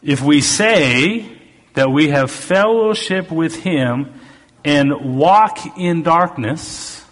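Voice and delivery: slow, emphatic preacher's reading; scripture reading. Acted. Preacher rereading verse five to his congregation, slow and emphatic. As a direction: proclaim it deliberately, stretching the key words.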